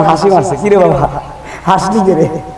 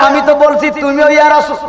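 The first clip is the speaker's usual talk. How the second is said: higher, pressing urging; raised urging; 8 semitones higher, faster than usual. Retold or imitated. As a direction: push the words higher, urging. Retells the same words, raised.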